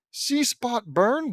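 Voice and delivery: "See spot burn" slow and high, rising at the end; puzzled, drawn-out re-reading with a rise; baffled question. Acted.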